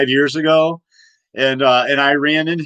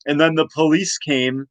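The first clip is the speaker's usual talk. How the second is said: ordinary talk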